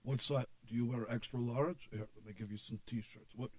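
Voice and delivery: lightly voiced salesman quietly listing his wares. Voices [name] offering merchandise.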